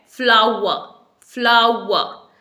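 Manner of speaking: फ्लावर as FLAU-wa, silent r; correct pronunciation. Shown as a demonstration. Teacher giving the right way, फ्लावर as FLAU-wa. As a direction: slowly say फ्लावर with w, ending in open "a". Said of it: "इसकी जो सही pronunciation है".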